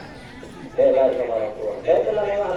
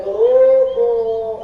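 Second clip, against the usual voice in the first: long drawn-out street seller call; vendor's call; 3 semitones higher, 3 dB louder, much slower than usual. Retold or imitated. Preacher imitating a cracker seller calling out. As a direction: stretch it like a hawker calling door to door.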